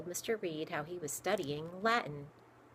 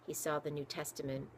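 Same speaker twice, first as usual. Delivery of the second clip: usual speaking voice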